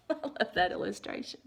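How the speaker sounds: usual speaking voice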